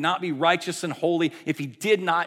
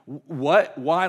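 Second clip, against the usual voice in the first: louder, raised-pitch disbelief; floored response; 3 semitones higher, 8 dB louder, slower than usual. Acted. Preacher voicing our shock at being forgiven, loud and incredulous. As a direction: stammer into a stunned question. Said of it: "we should have a response of like".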